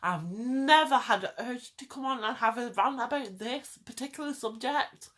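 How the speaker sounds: usual speaking voice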